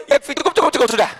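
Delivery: high, urgent plea to stop; hurried surrender. Acted. King stops the sufi as walls crack, high urgent plea. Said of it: "Lalu dia bilang".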